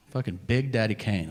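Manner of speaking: usual speaking voice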